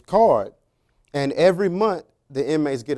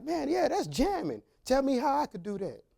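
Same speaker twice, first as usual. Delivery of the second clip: high, mock-eager gushing; sarcastic mock excitement; 9 semitones higher, 7 dB quieter than usual. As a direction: sarcastically play the impressed fan. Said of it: "So I'm like".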